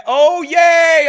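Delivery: loud, high-pitched cheer; a high, whooping burst of excitement; excited cheer. Real, not acted. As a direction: shout it out with delight. Excited reaction during live show.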